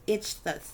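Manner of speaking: Ichthus said as "ITCH-thus"; careful pronunciation. Shown as a demonstration. Speaker tells viewers how the Greek name is pronounced, careful Ichthus as "itch-thus". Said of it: "I believe it's pronounced".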